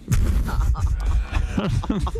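breathy unvoiced wheeze of a laugh; wheezing laugh. Real, not acted. Host wheezing with laughter at his own dare. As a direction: crack up in a silent wheeze.

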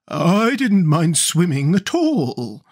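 slow, drawn-out horse voice; leisurely put-on creature voice; talking sawhorse. A narrator's reading. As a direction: play a wooden horse, stretching the words. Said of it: "remarked the horse".

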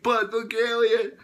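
high, loud "look, alien!"; Woody tricks Buzz, loud, high and eager; excited trick. Retold or imitated. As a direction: shout it to fool him.

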